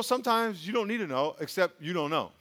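normal talking voice

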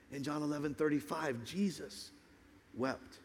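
soft, low, tender sermon voice; hushed, solemn preaching. Real, not acted. Preacher telling that Jesus was moved and wept, hushed and solemn. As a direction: bring it down almost to nothing, reverently.

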